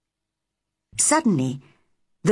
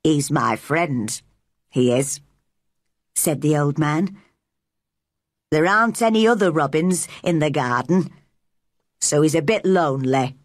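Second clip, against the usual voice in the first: Yorkshire dialect; 4 semitones lower than usual. A narrator's reading. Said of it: "He spoke in strong Yorkshire dialect".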